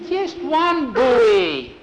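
high, loud, very slow just one Bowie; insistent correction. Acted. Bowie corrects Skeeter again, slow and loud. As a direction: drag it out firmly.

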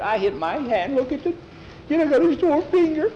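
put-on high-pitched voice of a hurt kid; hurt boy's complaint. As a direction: complain way up high about a sore finger. Hurt boy complains about his finger.

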